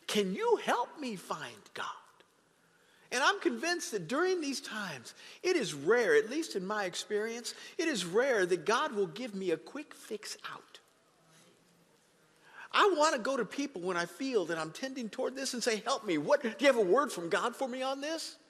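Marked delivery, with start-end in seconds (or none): 0.0-1.8 pleading request. Retold or imitated. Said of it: "asking them to help you". 12.7-18.4 higher and louder, pressing; preaching. Acted. Preacher urging the church to seek counsel, raised preaching.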